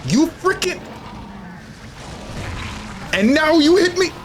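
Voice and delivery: very high, squealed frustration; exasperated outburst. Real, not acted. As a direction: squeal it in outrage at being hit. Player hit by monster in game.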